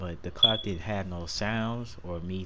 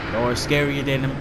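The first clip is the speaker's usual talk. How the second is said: much louder, emphatic complaint; 4 semitones higher, 10 dB louder than usual. Real, not acted. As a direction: make it loud and sure. Fear of monsters.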